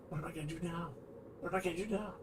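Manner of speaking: quiet panicked muttering; muttering to self. Retold or imitated. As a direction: mutter it under the breath, worried. Panicked inner thought.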